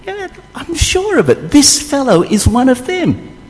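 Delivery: high, insistent maid's voice; servant girl. Acted. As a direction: insist like a girl who is certain. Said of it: "the servant girl said again".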